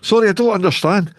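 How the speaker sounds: high, hammed-up confusion; mock bafflement. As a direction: act utterly lost at the local phrase. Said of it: "You're like".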